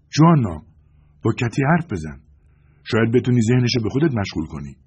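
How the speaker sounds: loud, high call across the room; calling loudly. A narrator's reading. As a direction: call out to get her attention. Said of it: "بلند گفتم". (صدا زدن بلند)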